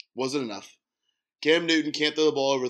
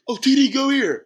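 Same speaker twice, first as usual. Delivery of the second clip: loud, high on-field yell; mimicked player shouting a play; imitated yell; 12 semitones higher, 9 dB louder than usual. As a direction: bark out a call across the field. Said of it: "And he, like, yells".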